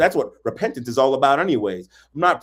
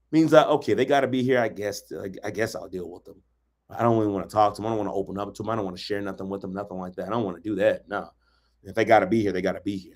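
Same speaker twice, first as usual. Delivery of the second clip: grudging tolerance; 7 semitones lower, 8 dB quieter, faster than usual. Retold or imitated. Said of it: "you'll be tolerated".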